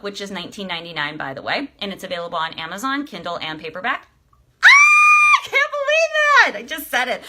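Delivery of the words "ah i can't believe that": real high squeal of joy, then a loud exclaim; a burst of real excitement, high and loud; excited shriek. Real, not acted. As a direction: shriek it out in disbelief and delight. Announcing her book is done.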